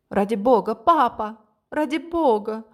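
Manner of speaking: high, loud pleading cry; desperate cry. A narrator's reading. The daughter desperately begs her father to spare Kerim. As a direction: beg your father not to shoot. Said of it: "С отчаянным криком".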